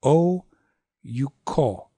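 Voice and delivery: long "Oh", short "you", long "ko"; short middle syllable. Shown as a demonstration. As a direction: lean on Oh, pull away from you. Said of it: "make that short".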